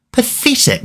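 "Pathetic" shot up very high in outrage; outraged. Real, not acted. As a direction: cry "Pathetic" in high-pitched disbelief. Conceding a penalty at home.

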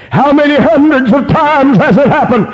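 high, urgent revival-preacher cry; fervent preaching. Real, not acted. Preaching at full force.

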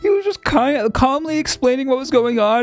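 high-pitched, laughing disbelief; incredulous. Real, not acted. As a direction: demand an explanation, pitch soaring, key words stretched. Stunned by the bizarre voice glitch.

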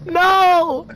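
desperate yelling at the screen; panicked shouts. Real, not acted. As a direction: yell it out as you go down. Dying in game, panicking.

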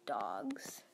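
hushed, teasing whisper; dramatic whisper. Pretend. Whispering it to her viewers for suspense. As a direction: whisper the word like a secret reveal.

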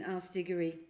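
ordinary delivery

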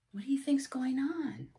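ordinary delivery, nothing marked

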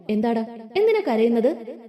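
plain reading voice

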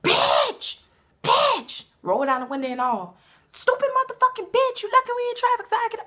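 high, loud shrieking road-rage insults; road rage yelling. Pretend. Cut off in traffic, yelling at driver, high and loud. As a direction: scream out the car window at a driver. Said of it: "I be like this".

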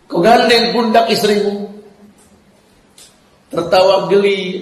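ordinary talk, nothing marked

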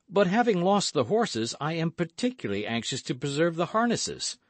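high-pitched, urgent protest; worried, high-voiced resistance; anxious objection. A narrator's reading. D'Artagnan resists staking the harnesses.

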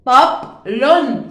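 طبل stretched slow and loud, syllables apart; slow syllable split. Shown as a demonstration. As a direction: draw out طبل syllable by syllable for the class. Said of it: "خلينا نكتب". (نطق مقطّع بطيء)

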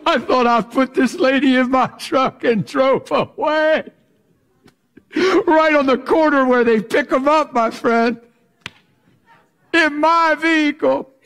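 a pastor laughing at his own mistake; laughing disbelief. Real, not acted.

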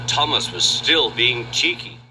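ordinary delivery, nothing marked

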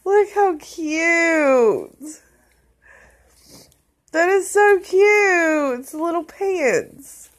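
high, drawn-out weepy coo; happy crying. Real, not acted. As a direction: melt into tears over something tiny and cute. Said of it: "Okay, I am crying".

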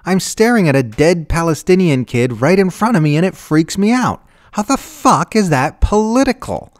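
high, loud, distraught rant; freaked-out protest at full pitch; outraged screaming. A narrator's reading. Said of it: "Susan screamed".

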